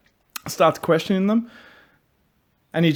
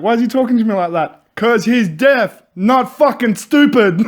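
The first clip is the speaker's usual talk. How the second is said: mock exasperated retort; 6 semitones higher, 5 dB louder than usual. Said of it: "He's like".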